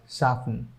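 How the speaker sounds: "soften" with the T left silent. Shown as a demonstration. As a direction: say "soften" without sounding the T. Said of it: "that will be pronounced as".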